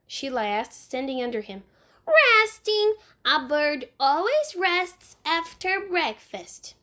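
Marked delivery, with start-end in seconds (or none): 2.1-6.7 put-on high little boy voice, slow; a small child explaining matter-of-factly. Fudge in the tree explaining to Sheila, in a high little boy voice.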